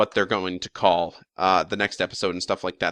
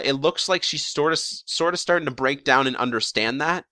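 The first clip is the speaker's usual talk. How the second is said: ordinary talk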